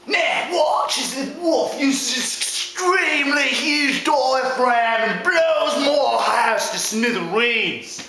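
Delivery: slow, showy nature-host commentary; drawn-out Australian-style narration of danger; Aussie first pig. Acted. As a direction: marvel like a wildlife presenter.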